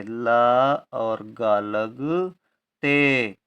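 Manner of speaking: ला, गा and लगते stretched very slowly; drawn-out dictation. Shown as a demonstration. Teacher asking pupils to notice लगते, sounding it out very slowly. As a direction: pull each syllable long so pupils can follow. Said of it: "ध्यान दें, लगते".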